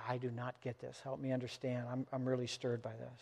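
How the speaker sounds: quietly, as if asking a friend for help; a gentle, hushed request for understanding; soft confiding. Retold or imitated. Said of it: "sit down and say".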